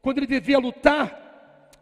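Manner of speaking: loud, high fiery sermon; full-force preaching. Real, not acted. Pastor urging believers to fight, preaching loud. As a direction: drive it home to the congregation.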